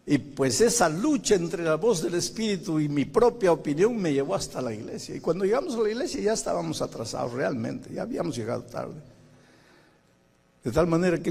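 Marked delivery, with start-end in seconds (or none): none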